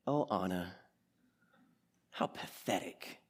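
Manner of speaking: low, hushed mock-villain voice; scornful betrayer. Hans betrays Anna in the retold film, low scorn. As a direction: drop low and slow into quiet contempt.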